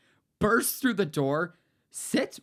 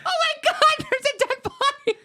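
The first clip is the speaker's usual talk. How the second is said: much higher, louder shriek through laughter; mock kid scream; 6 dB louder than usual. Kids finding a coworker asleep in the ball pit, mock panicked shriek.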